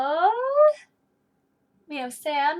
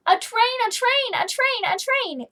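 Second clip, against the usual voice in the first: higher, thrilled announcement; excited call; 9 semitones higher, 5 dB louder than usual. Acted. Sam excitedly shows grumpy guy a train, bright call. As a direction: point and cry it out gleefully.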